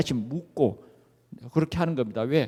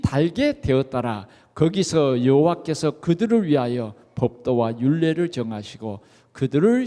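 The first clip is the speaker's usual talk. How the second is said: scripture reading; 3 semitones lower, 4 dB louder than usual. Acted. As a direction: read the verse together, measured. Congregation reads scripture together.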